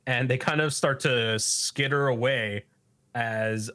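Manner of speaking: ordinary delivery, nothing marked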